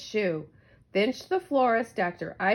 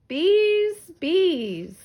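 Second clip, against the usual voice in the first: rising cry of bees, loud and high; buzzing bees; 8 semitones higher, 6 dB louder than usual. A narrator's reading. Narrator crying out the buzzing swarm loudly. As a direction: call out the bees as they swarm.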